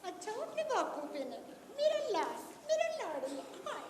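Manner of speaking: soft doting mother; hushed, adoring baby talk; tender cooing. Acted. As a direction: coo quietly over your baby. Mother coos over her baby.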